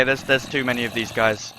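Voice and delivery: usual speaking voice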